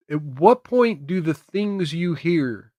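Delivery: ordinary delivery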